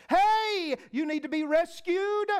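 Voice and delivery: high, loud hail from a boat; acted-out rescuer's call. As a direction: shout an offer across the water. Boatman calling to man on roof.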